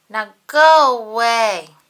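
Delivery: loud slow grouch yell. A narrator's reading. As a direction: bark him away with a big yell. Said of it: "yelled Oscar".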